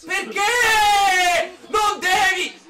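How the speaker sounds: high, drawn-out lament; high exasperated yell, words stretched out; scolding shout. Real, not acted. Scolding someone after a bad play.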